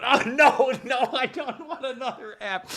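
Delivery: high laughing plea, slow; mock despair. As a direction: whine it high through laughter. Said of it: "I'm going".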